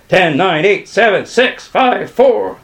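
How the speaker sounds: loud count rising in pitch; loud, mounting countdown; shouted countdown. A narrator's reading. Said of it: "Plantinga shouted".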